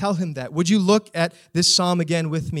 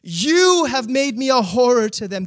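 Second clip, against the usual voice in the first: loud, high shaking-fist cry to God; preacher acting out a despairing psalmist's anguished shout; anguished protest; 5 semitones higher, 6 dB louder than usual. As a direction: hurl the psalm at heaven in protest. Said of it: "Do you hear him?".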